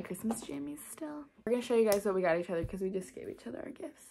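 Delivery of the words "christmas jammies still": hushed morning whisper. Real, not acted. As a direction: whisper it softly.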